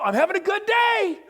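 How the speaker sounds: high, loud chirpy good-day voice; mock cheery high. Speaker mocking moody people, chirpy and loud. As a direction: bounce it up like a giddy coworker.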